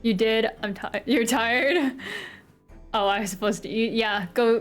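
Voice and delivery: ordinary delivery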